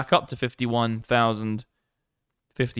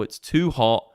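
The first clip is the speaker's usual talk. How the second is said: ordinary talk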